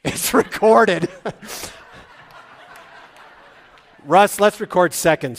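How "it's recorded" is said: laughing. Real, not acted. Joke about the recording.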